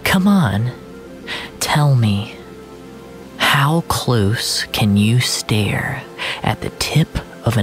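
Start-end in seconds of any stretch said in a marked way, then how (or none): none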